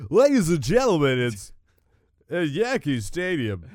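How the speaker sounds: booming stadium announcer; baseball announcer. Acted. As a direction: announce it loudly to the whole ballpark. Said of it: "I sound like a baseball announcer".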